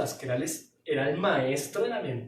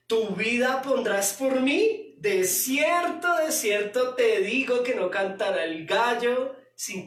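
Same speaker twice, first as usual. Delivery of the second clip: higher, louder, slow solemn reply; solemn Jesus; 5 semitones higher, 5 dB louder, slower than usual. Acted. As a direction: draw it out like a grave rebuke. Jesus answers Peter, verse read. (réplica solemne y lenta)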